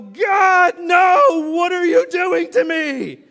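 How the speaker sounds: shouted high and loud in mock protest; hammed-up cry of innocence at God; mock outraged shout. Man caught on his phone shouting at God in mock outrage. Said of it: "can you really go".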